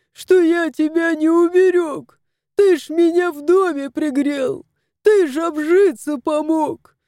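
mock-tearful wail, rising high; sobbing. A narrator's reading. House spirit begs the dying cat's forgiveness, wailing. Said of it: "рыдал домовой".

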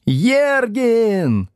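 high-pitched classroom yell; class shouting. A narrator's reading. Pupils shout false surnames to the teacher, yelling. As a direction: yell out a name like a rowdy pupil. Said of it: "закричали Слушкину".

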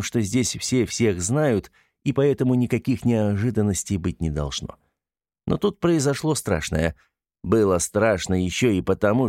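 usual speaking voice

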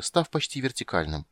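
ordinary delivery, nothing marked

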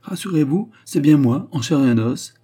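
joyful exclamation. A narrator's reading. A returning suitor greets his startled fiancée, joyfully exclaiming. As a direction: exclaim it gladly, voice jumping up. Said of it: "s'exclama joyeusement Préfontaine".

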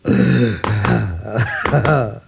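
chuckle. Acted. Old man recalls first love.

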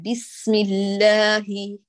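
بسم الله drawn out slowly; slow model recitation. Shown as a demonstration. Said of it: "لا تقول الله".

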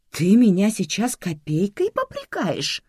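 slow, high, affronted question; outraged, drawn-out challenge; indignant. A narrator's reading. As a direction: climb in pitch with disbelief. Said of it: "возмутилась Светлана Степановна".

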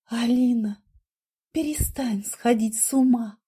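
urgent pleading whisper. A narrator's reading. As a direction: plead under breath.

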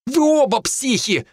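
high, drawn-out outraged bark; high-pitched furious accusation; angry bark. A narrator's reading. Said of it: "рявкнул Хинниган".

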